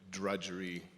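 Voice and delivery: ordinary talk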